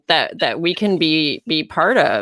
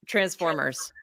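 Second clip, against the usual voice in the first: ordinary talk, nothing marked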